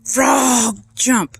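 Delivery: drawn-out yelled command; mock scream. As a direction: scream the order, play-acted. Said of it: "He screamed again".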